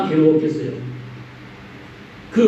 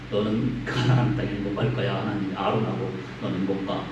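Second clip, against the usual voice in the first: God's verdict, low and hushed; 7 semitones lower, 8 dB quieter than usual. Retold or imitated.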